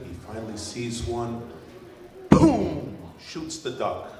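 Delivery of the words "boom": loud, high boom with falling tail; imitated gunshot. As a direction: fire the shot with your voice.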